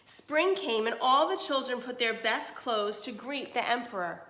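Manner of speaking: clear, even storybook reading; reading to children. Acted. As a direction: read it to a class of kids. Reads picture book to class.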